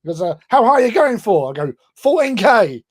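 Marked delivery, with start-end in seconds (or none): 0.5-1.5 high, loud imitation of an eager American; hyped-up American. Mimicking an American asking about the jump height, hyped-up. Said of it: "like, really hyped up". 1.9-2.8 high, loud, excited announcement; mock bravado. Skydiver retelling his answer to the Americans, mock bravado. Retold boast to hyped-up Americans.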